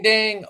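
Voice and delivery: a high, sung-out ding; mock bell ding. Mocking divine confirmation with a high, bright ding. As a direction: ring it like a heavenly chime.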